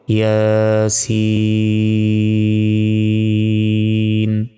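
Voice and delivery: ইয়াসিন sung slowly with the last vowel stretched; drawn-out recitation. Shown as a demonstration. Teacher modelling the pronunciation of ইয়াসিন for students, slow chant. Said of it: "এটার উচ্চারণ হবে".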